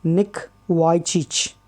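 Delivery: Vujicic said as "WHY-chich"; alternative pronunciation. Shown as a demonstration. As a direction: say Vujicic with "why" as the first syllable, then chich. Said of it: "some pronounce it as".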